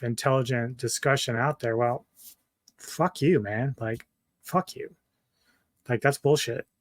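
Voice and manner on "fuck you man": normal talking voice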